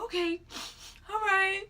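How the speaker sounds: high, whimpering mock agreement; re-enacted weepy voice; imitated tearfulness. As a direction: agree through pretend sobs. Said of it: "still crying".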